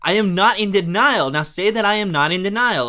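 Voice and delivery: hammed-up indignant yell; insistent shouting. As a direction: shout it down like a bickering kid. Acted sibling argument boils over.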